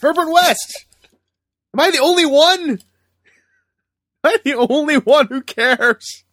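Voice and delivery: high, strained shout of the name; frustrated shouting. Real, not acted. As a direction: yell it out, exasperated nobody cares. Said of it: "Being quiet, guys".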